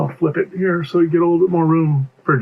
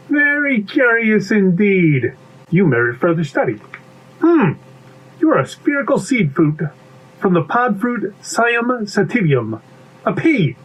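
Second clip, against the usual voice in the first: higher, studious character voice; inquisitive bee; 7 semitones higher than usual. Acted. Bee identifies the silent stranger as a pea, studious.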